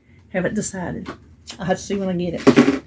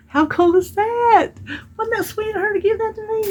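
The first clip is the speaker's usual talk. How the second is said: excited delight. Real, not acted. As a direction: gush over the little gift. Showing off the gifted pirate.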